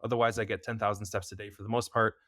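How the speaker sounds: ordinary talk, nothing marked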